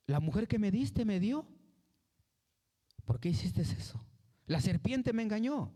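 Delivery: excusa aguda e imitada; échale la culpa a otro; excusa a la defensiva. Retold or imitated. Adán y Eva se excusan. (Adán y Eva excusándose ante Dios, agudos y defensivos)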